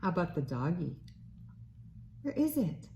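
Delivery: bright sing-song read-aloud voice; reading to a toddler. Acted. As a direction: read it like to a little one. Reads a picture book to baby.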